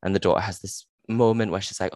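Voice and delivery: usual speaking voice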